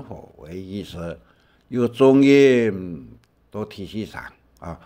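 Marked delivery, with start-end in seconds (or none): 1.7-3.1 有重音 slow and loud; stressed demo. Shown as a demonstration. Teacher stressing 有重音 slowly and loudly in class. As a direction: lean hard into 有重音.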